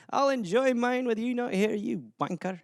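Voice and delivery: soft Scottish grumble, fading away; muttered Scottish aside; under his breath. Acted. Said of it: "talks under his breath".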